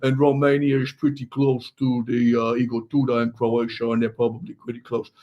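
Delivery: mock Romanian accent, pitched low; low-pitched, improvised Romanian accent; Radu Dragutin. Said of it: "talks something like this 'cause he comes from Romania".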